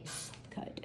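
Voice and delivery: breathy unvoiced whisper. Real, not acted. Savouring the memory of Antonio's ranch, whispered. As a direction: whisper it like a guilty pleasure.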